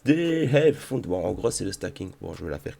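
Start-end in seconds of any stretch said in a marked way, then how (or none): 0.0-1.1 put-on English accent, slow and careful. Shown as a demonstration. YouTuber showing off for viewers who like his English, English accent. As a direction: roll the English name out in a deliberate English accent. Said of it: "je me force un petit peu à faire, euh, mon petit accent".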